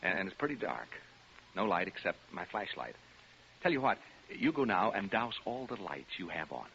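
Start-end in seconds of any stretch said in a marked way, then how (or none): none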